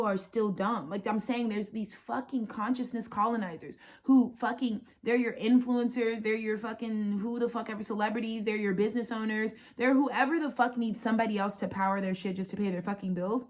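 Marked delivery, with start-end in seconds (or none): none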